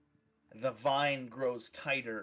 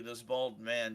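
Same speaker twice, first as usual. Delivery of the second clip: ordinary delivery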